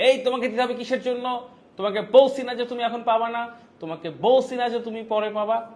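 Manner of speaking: put-on loud, high angry scolding of a child; angry scolding parent. A parent scolding a demanding child, loud and angry. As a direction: snap back at the stubborn kid. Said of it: "আমরাও রাগটা দেখাই".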